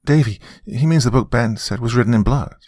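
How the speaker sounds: high, put-on helpful tone; play-acting. A narrator's reading. Grandmother prompts Davy about the book, play-acting. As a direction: explain it with feigned innocence. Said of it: "She was acting".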